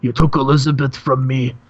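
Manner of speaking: a hint of a gravel-voiced wrestling star; Randy Savage voice. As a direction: channel the Macho Man cutting a promo. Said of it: "cuts him off and says".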